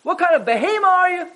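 shrill mock-tyrant outburst at toys on the floor; tyrant parent yelling. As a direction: bellow at the kid like a household tyrant. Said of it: "you're a tyrant".

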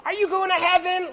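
high, loud street-preacher call; hammed-up zealous shout; excited preacher. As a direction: call it out like a train evangelist. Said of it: "super excited".

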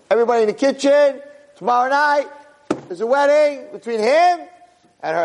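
high, calling-out proclamation; public announcement. Acted. Master announcing the wedding to kitchen staff, public announcement. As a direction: call it out to the whole room.

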